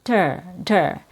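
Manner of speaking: soft, reduced "der" with a schwa; unstressed "der", quick d; weak vowel. Shown as a demonstration. Teacher showing the third syllable of computer, weak. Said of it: "a weak vowel sound".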